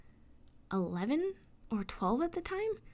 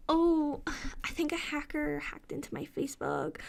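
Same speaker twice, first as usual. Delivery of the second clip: mock excuse; 6 semitones higher than usual. Mocking Kathy's excuse.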